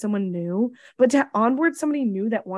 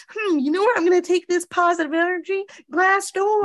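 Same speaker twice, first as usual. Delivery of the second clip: higher, sing-song parody of a happy customer; mock cheerful reviewer; 8 semitones higher than usual. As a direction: lightly send up a sunny reviewer, pitch raised.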